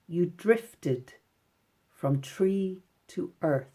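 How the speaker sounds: very slow, measured poem reading, pausing between phrases; poem read aloud. Shown as a demonstration. Teacher reads out the poem for the class to count syllables, slow poem reading. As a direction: read it out very slowly, each word spaced for counting. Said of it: "I'm gonna read it out for you".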